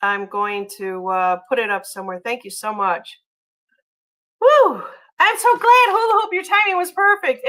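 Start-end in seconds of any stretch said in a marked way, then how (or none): 4.4-7.5 high, loud burst of joy; real delight at perfect timing; excited. Real, not acted. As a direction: whoop it out, thrilled. Thrilled the gift arrived on time.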